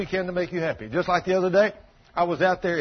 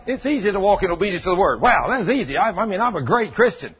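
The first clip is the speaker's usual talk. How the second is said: smug boast; 4 semitones higher, 5 dB louder than usual. Retold or imitated.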